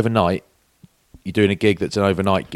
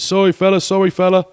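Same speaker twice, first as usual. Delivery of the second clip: high, apologetic cabbie voice; sheepish cabbie; 11 semitones higher, 5 dB louder than usual. Retold or imitated. Cabbie apologising to the passenger, high sheepish voice. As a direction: play the cabbie, caught out and sorry. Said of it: "He said".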